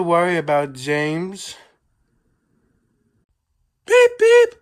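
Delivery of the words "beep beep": very high, loud beeping whistle; engine whistle beep. A narrator's reading. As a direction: toot it like a train horn.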